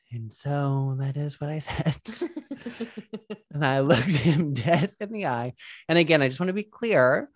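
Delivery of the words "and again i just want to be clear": ordinary talk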